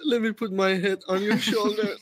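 high playful voice; playful plea. Pretend. Playful role-play while giggling.

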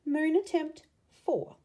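usual speaking voice

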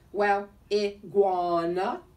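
slow taunting storybook figure; teasing character voice. A narrator's reading. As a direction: stretch the words out loudly to tease. Ornament's teasing reply in story.

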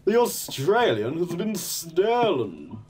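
dramatic announcer. Pretend. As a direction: announce it gravely and slowly. Mock game announcer line.

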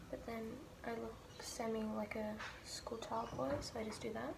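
soft unvoiced whisper. Real, not acted. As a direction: hush it, keep it secret. Whispering vlog while out.

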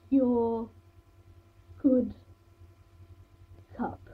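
YOUR, GOOD, CUP with long pauses between; loud YOUR and GOOD, each word standing alone. A narrator's reading. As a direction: spell out "Your ... good ... cup" in slow disbelief. Repeats word by word, staring.

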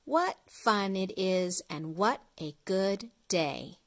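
ordinary delivery, nothing marked

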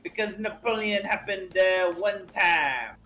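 put-on deliberate, halting French-accented speech. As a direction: lay out a paranoid plan as a Frenchman. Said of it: "in your French accent".

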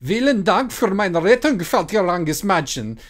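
high, loud German, the last words drawn out very slowly; begrudging German. Acted. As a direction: thank her grudgingly in German. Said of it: "Brandy said begrudgingly".

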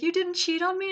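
high-pitched, nearly-crying question; mock tearful. Husband in disbelief to his wife, imitated as nearly tearful. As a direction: imitate someone almost in tears. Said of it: "he's, like, almost in tears".

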